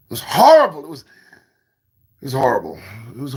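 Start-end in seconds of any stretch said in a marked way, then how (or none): 0.1-0.8 mock sob. Lamenting yesterday's snafu.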